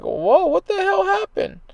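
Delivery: high-pitched stunned disbelief, drawn out slowly; retold shock. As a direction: squeak it out like you can't believe the number. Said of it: "And I'm like".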